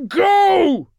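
very high, full-force roar; huge, desperate bellow. A narrator's reading. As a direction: roar the order with everything left. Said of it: "he roared".

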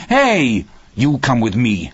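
grim anger. A narrator's reading. Hal pulling his son to the door, grim anger. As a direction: call out sharply, then order grimly. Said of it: "Hal said grimly".

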